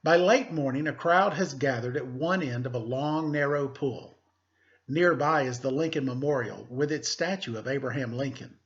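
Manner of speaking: teacher reading a picture book aloud; story reading. Acted. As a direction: clear read-aloud storytelling voice.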